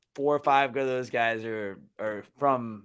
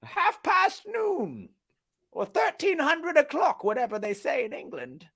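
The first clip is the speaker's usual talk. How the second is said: mock English accent; 10 dB louder than usual. Podcaster mocking England, high hammed-up English accent. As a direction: play a toff checking his pocket watch, mock English accent. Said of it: "making fun of England".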